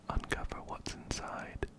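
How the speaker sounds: usual speaking voice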